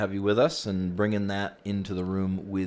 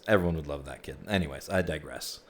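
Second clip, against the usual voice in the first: ordinary delivery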